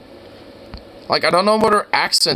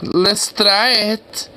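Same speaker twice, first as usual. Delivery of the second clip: slow, higher put-on echo; mimicking video woman; 5 semitones higher, much slower than usual. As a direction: parrot her phrase, drawing it out. Mimics woman in played clip.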